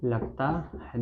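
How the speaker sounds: slow, low dictation with long pauses. Real, not acted. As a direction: dictate softly, word by word. Dictating slowly while writing it out. (धीमे, रुक-रुक कर लिखवाते हुए)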